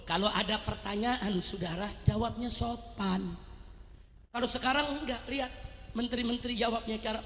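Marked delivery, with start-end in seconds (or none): none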